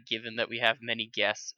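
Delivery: ordinary talk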